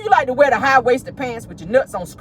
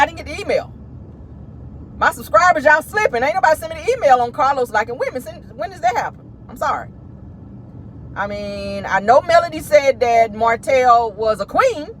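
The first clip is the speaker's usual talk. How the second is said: ordinary talk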